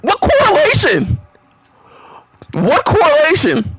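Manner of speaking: high, disbelieving outburst; can't believe what you're hearing; squeaky shocked protest; incredulous. Acted. Indignant reaction to breakup.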